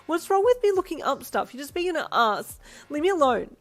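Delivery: loud, high tearful rant; loud high weepy rant; crying outburst. Acted. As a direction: rant at him while crying.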